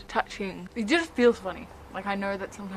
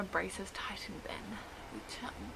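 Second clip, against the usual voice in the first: whispering; 12 dB quieter, slower than usual. Real, not acted. Unexplained sudden whisper.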